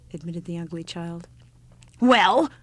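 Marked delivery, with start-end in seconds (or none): 2.0-2.5 louder, higher deaf-granny shout. Acted. Old woman shouting at the child on her doorstep, loud. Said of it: "shouted the old woman again".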